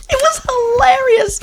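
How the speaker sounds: whispered through laughter; breathless laugh. Real, not acted. Telling her friend the punchline, laughing breathlessly. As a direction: barely get the words out for laughing.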